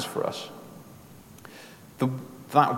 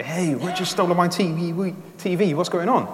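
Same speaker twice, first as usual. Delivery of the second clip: higher-pitched, indignant protest; mock complaint; 7 semitones higher than usual. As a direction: act out an aggrieved churchgoer complaining. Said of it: "complain and say".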